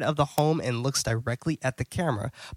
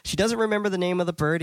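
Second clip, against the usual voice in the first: ordinary talk, nothing marked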